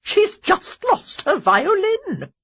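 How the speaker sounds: loud, sharp dowager bark. A narrator's reading. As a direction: scold her briskly. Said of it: "Lady Danbury barked".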